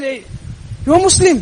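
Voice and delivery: high, emphatic announcement; pointed exclaiming tone. Retold or imitated. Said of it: "I said it in that tone".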